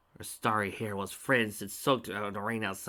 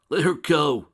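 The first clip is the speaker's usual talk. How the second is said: higher, louder commanding shout; 6 semitones higher, 11 dB louder, slower than usual. A narrator's reading. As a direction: shout the order. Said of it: "shouted the dragon".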